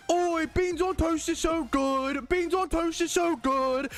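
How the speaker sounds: high, drawn-out mock British accent. Mocking British bean-lovers, high drawn-out British accent. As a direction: whine it out as a silly British caricature. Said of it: "Why do British people run up and down".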